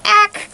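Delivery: high, loud squawk; loud startled ack. Shown as a demonstration. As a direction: shriek ack like in a scare. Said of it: "Pretending I'm in your kitchen".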